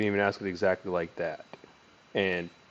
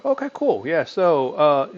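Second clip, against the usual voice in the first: bright and much louder, higher mock okay cool; mock cheerful reply; 7 semitones higher, 8 dB louder, slower than usual. Salesman answering news of a death, mock cheerful. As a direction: chirp okay cool as a bad reflex. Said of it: "they might say something like".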